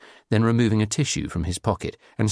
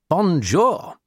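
high, loud mangled French greeting; hammed-up bad French accent; 10 semitones higher, 5 dB louder than usual. A narrator's reading. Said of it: "he said in a bad French accent".

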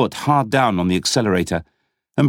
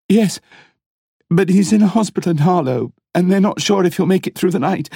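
distressed woman; 8 semitones higher than usual. A narrator's reading. Emma voiced, distressed.

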